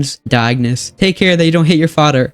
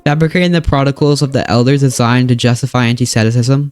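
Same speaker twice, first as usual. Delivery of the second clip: ordinary talk, nothing marked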